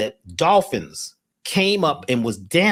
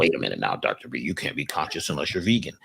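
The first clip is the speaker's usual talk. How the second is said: much lower, mock preachy vegan voice; 11 semitones lower, 6 dB quieter than usual. Mocking vegans who lecture Doctor B, lower put-on voice. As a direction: parody the self-righteous vegan in a deep put-on voice. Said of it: "Some people say".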